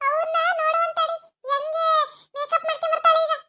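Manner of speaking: high, sneering taunt; wife taunts her mother-in-law, high and mocking. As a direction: needle her with a jeering lilt.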